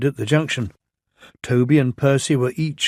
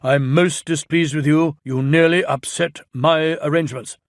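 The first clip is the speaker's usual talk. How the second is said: louder stern scolding; 4 semitones higher, 4 dB louder than usual. Acted. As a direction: scold firmly.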